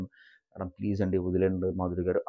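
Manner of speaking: soft, low, gentle request. Retold or imitated. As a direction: ask softly and mildly, keeping the voice low. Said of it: "చాలా సౌమ్యంగా చెప్తారు". (సౌమ్యంగా, మెల్లగా)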